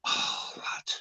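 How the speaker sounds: breathy whisper of pure delight; hushed, savouring whisper; whispered relish. Real, not acted. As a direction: whisper it like a guilty pleasure. Savouring cheese on crumpets.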